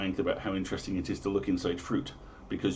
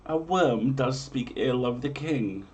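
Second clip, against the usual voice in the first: grand, raised olde-English storyteller read; dramatic prophecy reading; 6 semitones higher, 3 dB louder than usual. Acted. Host reading medieval omens to listeners, grand storyteller voice. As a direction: proclaim each omen like a town crier.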